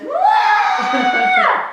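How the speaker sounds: high, loud imitated shower scream; mock shriek. Sister screaming in the shower, loud shriek. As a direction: wail like a panicked kid.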